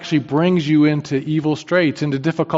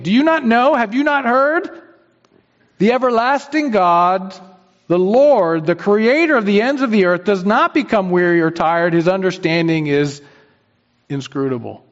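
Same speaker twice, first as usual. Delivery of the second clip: scripture reading; 5 semitones higher, 3 dB louder than usual. Acted. Reads scripture aloud.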